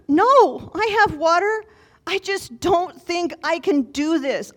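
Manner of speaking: high-pitched retold meltdown, loud at first; exasperated rant. Tired pilgrim rants to a passing cyclist, acted exasperated despair. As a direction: re-enact an overwhelmed, high-pitched protest.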